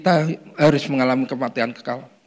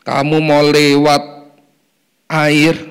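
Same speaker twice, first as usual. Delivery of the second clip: slow emphasis; 6 dB louder, much slower than usual. Real, not acted.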